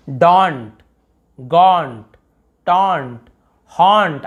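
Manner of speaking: each word with a rounded "aw"; long "aw". Shown as a demonstration. Teacher reading example words, long rounded "aw". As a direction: stretch the vowel in डॉन्ट, गॉन्ट, टॉन्ट, हॉन्ट. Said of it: "आ बोल रहे".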